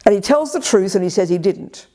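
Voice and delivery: ordinary talk, nothing marked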